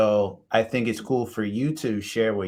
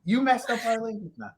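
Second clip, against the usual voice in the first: mock indignant Brit; 11 semitones higher than usual. Mock British pride after phrase teasing.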